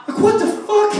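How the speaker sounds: high-pitched comic incredulity; retold disbelief. Comic reacting to the yelling man, high mock disbelief. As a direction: squeal your bewildered protest, replaying the moment.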